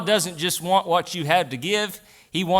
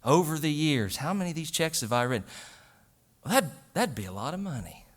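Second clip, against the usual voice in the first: musing to himself; 2 semitones lower, 6 dB quieter than usual. Retold or imitated. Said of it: "I'll look at that check and I think".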